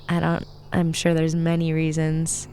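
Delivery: usual speaking voice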